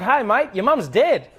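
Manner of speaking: upbeat Australian with a laugh; Australian accent. Retold or imitated. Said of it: "Australian accent is that you always sound happy".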